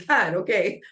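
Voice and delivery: imitating someone fighting back tears, throat clear then a high woo; mock choked up. Showing how Mark choked up, imitating tearful gulp. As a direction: clear the throat, gasp out words like about to cry. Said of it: "you know how when you start crying".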